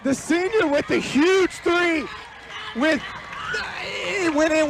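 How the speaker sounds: high and slowed, drawn out with delight; excited praise. Real, not acted. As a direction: hail the shot with excitement. Color man hails the shot.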